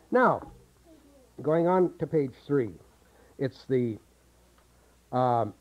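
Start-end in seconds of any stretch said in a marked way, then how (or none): none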